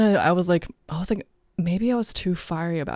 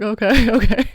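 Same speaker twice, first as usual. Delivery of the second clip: laughing through it, higher and louder; amused, unserious; 7 semitones higher, 7 dB louder than usual. Retold or imitated. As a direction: play along while cracking up. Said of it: "not taking it seriously at all".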